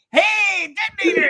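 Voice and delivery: high, loud little-creature call; small voice shouting up high; Grogu. Retold or imitated. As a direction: squeal out a warning. Imitating Grogu calling out.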